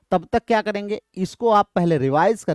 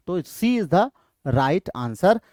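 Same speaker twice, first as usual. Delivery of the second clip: slow announcement; much slower than usual. Shown as a demonstration. Teacher announcing correct answer to class, slowly.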